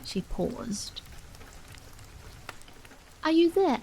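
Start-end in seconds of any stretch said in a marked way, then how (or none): 3.2-3.8 calling out. A narrator's reading. Mother calls through the door.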